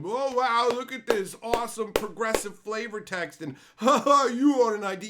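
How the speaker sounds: high, loud fawning mock with laughs; hammed-up fake praise; mocking applauding fan. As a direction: gush sarcastically. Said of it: "people will applaud that".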